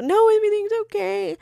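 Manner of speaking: high-pitched imitation of a reassuring boyfriend; mock reassurance. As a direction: pitch it way up, mimicking his insistence. Retells partner's insistent reassurance.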